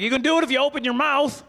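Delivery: high-pitched heckler quote; heckling audience member. Retold or imitated. Guy at a show offering the comic a tip, high-pitched imitation. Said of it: "He was like".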